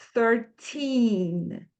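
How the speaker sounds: put-on Thirteen with a long stretched teen; long final ee. Shown as a demonstration. Teacher models the long ee at the end of Thirteen. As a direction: draw out the ee in Thirteen. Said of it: "É um som bem prolongado".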